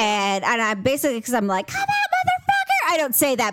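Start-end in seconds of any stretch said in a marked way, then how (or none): 1.7-2.5 very high mock battle cry; fighting challenge. Wife hunting a noise, high mock-aggressive challenge. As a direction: dare the intruder to come at you. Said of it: "in my mind, that's what I'm thinking".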